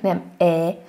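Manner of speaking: wide open Hungarian E, not French. Shown as a demonstration. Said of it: "mint nálunk".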